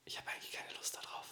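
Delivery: hushed whisper, no voice. Real, not acted. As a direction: breathe the words out. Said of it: "Flüstern mal ein bisschen".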